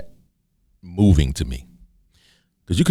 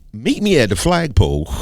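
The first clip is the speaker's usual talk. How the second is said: higher-pitched, hammed-up schoolyard challenge; mock fight challenge; 7 semitones higher than usual. Triggered person challenges someone over one word, mock fight challenge. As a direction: call someone out to fight, play-angry. Acting a hot-headed challenge.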